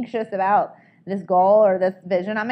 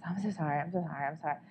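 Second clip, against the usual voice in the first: meek apology; 7 semitones lower, 15 dB quieter, much faster than usual. Retold or imitated. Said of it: "apologizing for ourselves".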